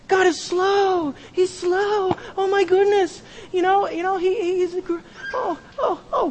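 high, airy sing-song mockery of soft faith; mocking naive believer. Mocking people who only see love.

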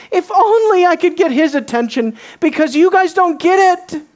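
pitched high and loud, pleading; a blind beggar straining for the king's attention; desperate cry. Acted. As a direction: beg to be heard over the crowd. Voicing desperate Bartimaeus crying out.

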